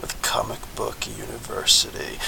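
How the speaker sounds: mock TV mumbling, dropping to a whisper. Shown as a demonstration. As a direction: fade down into a near-inaudible whisper. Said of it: "you lower your voice".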